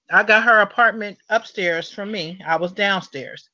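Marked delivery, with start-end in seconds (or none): none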